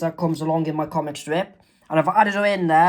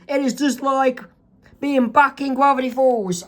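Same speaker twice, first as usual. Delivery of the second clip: higher, lightly voiced boy; Dipper's voice; 7 semitones higher than usual. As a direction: compare it to home. Dipper responds.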